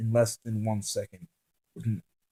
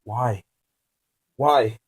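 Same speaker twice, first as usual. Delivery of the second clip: exclaiming; 5 dB louder than usual. Acted. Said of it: "exclaimed the words".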